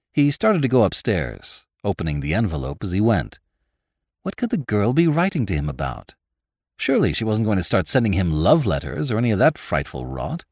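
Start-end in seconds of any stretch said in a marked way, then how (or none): none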